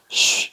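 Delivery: long hushing "shh"; shushing sound. Shown as a demonstration. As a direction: hush for silence, demonstrated. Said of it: "देखो ये श कर रहा है ना". (चुप कराने वाली लंबी "श" की आवाज़)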